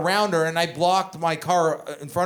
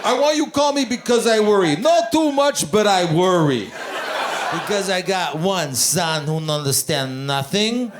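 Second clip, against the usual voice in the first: put-on Greek dad accent. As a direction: belt it like an anxious immigrant dad on voicemail. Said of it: "And he goes like this".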